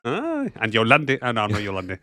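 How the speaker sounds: ordinary delivery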